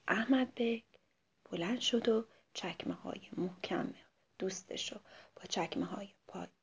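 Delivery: secretive hushed narration; hushed storytelling. A narrator's reading. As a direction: whisper it like a secret to the kids. Sneaking to swap the boots.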